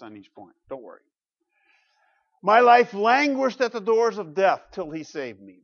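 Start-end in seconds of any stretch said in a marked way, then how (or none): 2.4-5.6 loud, higher scripture reading; dramatic reading. Shown as a demonstration. Pastor reading the psalm aloud to the church, dramatic. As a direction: read it with weight. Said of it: "Verses three and four".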